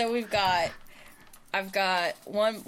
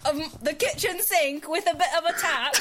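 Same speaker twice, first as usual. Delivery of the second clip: higher-pitched imitation British accent; mock British accent; 6 semitones higher than usual. Mimicking the friend's word for faucet, playful British accent. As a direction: put on a British accent through giggles. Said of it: "Oh, that was spot on".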